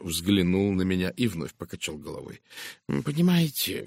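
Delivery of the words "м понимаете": understated regret in a higher voice; sad. A narrator's reading. Poirot breaks bad news to his friend, mildly sad. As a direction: begin hesitantly, with a touch of sorrow. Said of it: "грустно произнёс он".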